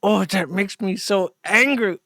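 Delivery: a light mock of a petulant angry gripe, the last words slow and drawn out; lightly voiced sulky rant, dragged out at the end; imitating Chris Chan. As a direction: whine it like a sulky man ranting to himself. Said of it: "he sounds like Chris Chan".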